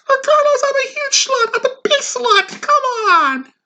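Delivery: very high mock woman's voice; falsetto woman. Daughter pleading with boyfriend, high falsetto. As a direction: squeal it like a silly woman.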